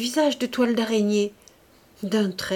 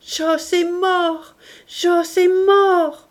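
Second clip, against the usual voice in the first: high, slow, louder wailing repeat; crow-like cry; 9 semitones higher, 6 dB louder, much slower than usual. A narrator's reading. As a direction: caw it out in grief like a crow in ruins. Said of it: "criait toujours avec sa voix de corneille".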